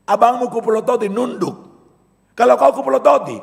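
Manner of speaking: loud and high, building up the story; high, loud storytelling about a stern father; loud, animated. Real, not acted. Preacher telling kids story, loud.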